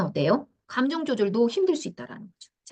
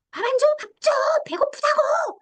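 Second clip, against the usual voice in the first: put-on child screaming. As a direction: shriek like a demanding kid. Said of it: "이렇게 악을 쓰고 있을 때". (훨씬 높고 크게 악쓰는 아이 흉내)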